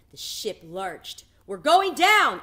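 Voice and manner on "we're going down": high, loud panicked yell; terrified shout. A narrator's reading. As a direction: bellow the warning as the ship lurches. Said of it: "a man shouted".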